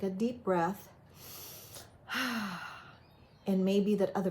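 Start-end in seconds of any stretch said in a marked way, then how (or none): 2.1-2.7 long, soft exhale. Shown as a demonstration. Speaker shows viewers a calming breath, soft sigh. Models taking a deep breath.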